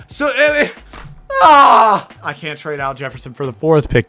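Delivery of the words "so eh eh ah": high strained whine into a loud yell; exasperated high wail; frustrated cracking scream. Real, not acted. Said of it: "that voice crack was nuts".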